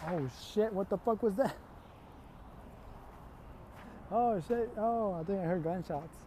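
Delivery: genuinely taken aback; real startled reaction. Real, not acted. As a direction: break off, startled by a sudden noise. Startled by a noise while talking.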